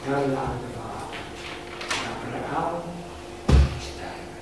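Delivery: soft, low, fading away; hushed poem ending. Acted. Father dies alone far from family, hushed recitation.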